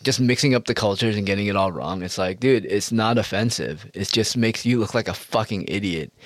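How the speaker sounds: ordinary delivery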